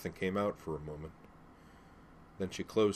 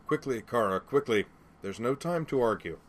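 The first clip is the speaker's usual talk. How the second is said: loud, raised-pitch urging; urgent command; 5 semitones higher, 5 dB louder than usual. Acted. Father rushing his daughter to escape, urgent command. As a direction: hurry her along, no room for argument.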